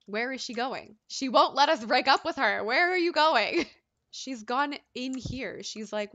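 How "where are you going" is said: mock-indignant raised voice, higher and louder; calling after her. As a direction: call out after someone running off. Calling after fleeing Sim.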